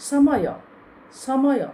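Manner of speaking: ସମୟ high and loud, tongue toward the teeth on ସ; emphatic dental ସ. Shown as a demonstration. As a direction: demonstrate the dental ସ in ସମୟ, forcefully, as a separate word each time. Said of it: "ଦାନ୍ତ ଆଡ଼କୁ ବାଡ଼ି ହେଉଛି". (ସମୟ: ଦାନ୍ତ ଆଡ଼କୁ ଜିଭ ନେଇ ଜୋରରେ ଦନ୍ତ ସ ଉଚ୍ଚାରଣ)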